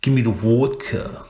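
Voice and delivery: hammed-up Russian accent. Shown as a demonstration. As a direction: put on a jokey Russian accent. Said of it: "with the Russian accent".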